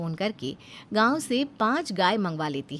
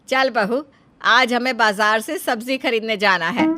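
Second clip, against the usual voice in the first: mother-in-law calling out; 3 semitones higher, 8 dB louder than usual. Acted. Mother-in-law summoning her daughter-in-law to market, loud call.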